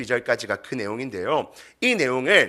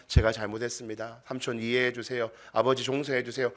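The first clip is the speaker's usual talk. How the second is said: low, soft acted pleading; humble apology; 3 semitones lower, 8 dB quieter than usual. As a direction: beg forgiveness meekly, head bowed. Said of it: "무릎 꿇고 고개 숙이며". (고개 숙여 낮게 비는 사과)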